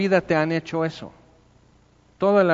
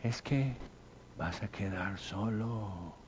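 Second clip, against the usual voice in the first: soft, slow, hushed little voice of doubt; little inner voice; 6 semitones lower, 15 dB quieter, slower than usual. Acted. The inner doubt whispers to the believer, soft and slow. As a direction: murmur it slowly like a doubt in your ear. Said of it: "una vocecita dice".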